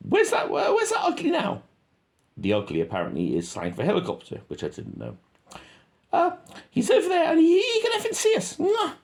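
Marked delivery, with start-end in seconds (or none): 0.1-1.6 much higher, squeaky soldier with a laugh in it. Retold or imitated. Soldier voiced from video. 6.1-8.9 much higher, squeaky soldier with a laugh in it. Retold or imitated. Soldier voiced from video.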